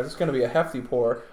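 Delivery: normal talking voice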